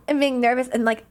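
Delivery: ordinary talk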